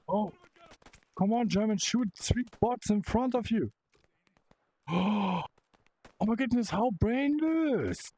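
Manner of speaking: exasperated. Real, not acted. Exasperated at useless teammate bots.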